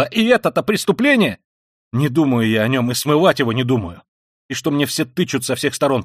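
loud and high furious outpouring; raging tirade. A narrator's reading. Raskolnikov raging at his sister about the crime. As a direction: spit it out in a fury. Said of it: "в каком-то внезапном бешенстве".

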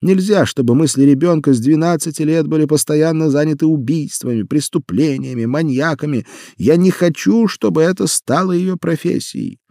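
high, loud, distressed protest; upset mother. Acted. Mother arguing in kitchen.

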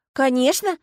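high, loud, emphatic assurance; confident. A narrator's reading. Agata assuring her friend, confident. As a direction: affirm it without any doubt. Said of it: "уверенно закивала Агата".

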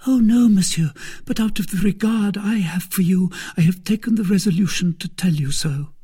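put-on high, eager young servant girl; timid maid reassuring a visitor. Kitty voiced high.

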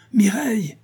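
high urgent shout of her name; shouting for Mireille. Acted. Marcel calling his wife downstairs, urgent high shout. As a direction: call her down in alarm. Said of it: "cria-t-il".

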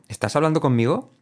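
usual speaking voice

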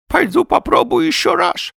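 high, loud toothless voice; old man declaring it in a high lisp. A narrator's reading. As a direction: pitch it way up, mushy sibilants. Old man announces another attempt.

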